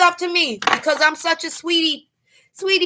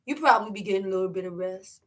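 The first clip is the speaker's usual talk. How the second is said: quick, softer, lower delivery; low and quiet; 7 semitones lower, 9 dB quieter, faster than usual. Real, not acted. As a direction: say it low, almost to oneself. First take, before the squealed repeat.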